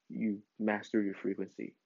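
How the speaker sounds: ordinary talk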